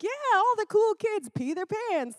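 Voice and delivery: very high goofy cheer; Adam Sandler hyping. Retold or imitated. Adam Sandler selling the kids on wet pants, very high. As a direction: squeak it out like a silly cool-kid pitch. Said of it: "And he's like".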